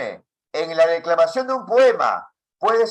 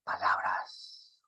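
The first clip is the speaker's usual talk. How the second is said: whispered word, drawn out slowly; low volume; 11 dB quieter, much slower than usual. Shown as a demonstration. Teacher demonstrating volume to the class, whispered. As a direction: say it very softly and slowly. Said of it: "yo puedo decir así".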